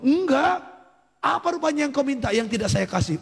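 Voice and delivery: acted high-pitched protest; indignant denial. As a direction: play a father protesting to his child. Acts father's emotional denial.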